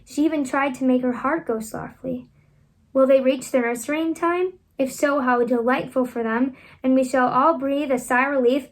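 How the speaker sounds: usual speaking voice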